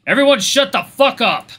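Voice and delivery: loud, high yell to silence a crowd; booming shout. Acted. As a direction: bellow it over a brawl. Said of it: "Bruce's booming voice silenced the crowd".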